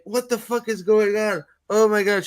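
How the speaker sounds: high-pitched mock bewilderment; confused. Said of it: "all confused".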